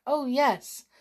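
high, loud pleased shout; shout from indoors. Acted. Martin shouting from indoors to his arriving friends. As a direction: call back gladly from another room. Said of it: "shouted Martin from indoors".

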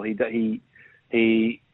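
usual speaking voice

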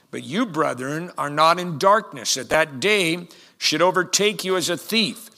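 scripture reading. Acted. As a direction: proclaim the verse forcefully to the congregation.

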